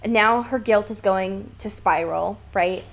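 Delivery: ordinary talk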